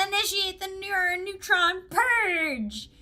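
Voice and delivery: high, hammed-up order with a drawn-out ending; theatrical high-pitched proclamation; dramatic command. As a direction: give a grand mock battle order, stretching the last word. Hammy command in the game.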